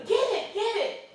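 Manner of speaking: high loud get it; urging shout. Retold or imitated. Husband urging his wife to hit the bag, high loud urging. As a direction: egg her on, shouting. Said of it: "He goes".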